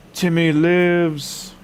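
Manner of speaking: high, mock-dramatic drawn-out call; drawn-out catchphrase. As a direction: stretch it out high, then let it fall.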